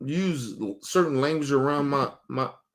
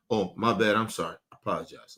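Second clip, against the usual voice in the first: low, soft, quick apology; hurried meek apology; 9 semitones lower, 6 dB quieter, faster than usual. Retold or imitated. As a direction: rush out a sheepish sorry. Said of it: "I'll be".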